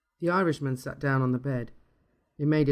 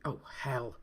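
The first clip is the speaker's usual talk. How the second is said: hushed, dismayed swearing; under-breath curse; 8 dB quieter than usual. A narrator's reading. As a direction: mutter the curse softly. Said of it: "cursed Frankie".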